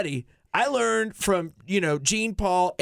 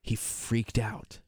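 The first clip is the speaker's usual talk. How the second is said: whispered; 6 semitones lower, 8 dB quieter, much slower than usual. Real, not acted. Hushed aside in backstage story.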